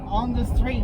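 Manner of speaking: high, loud talk over engine noise. Real, not acted. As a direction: speak up over the car's engine. Talking over engine while driving.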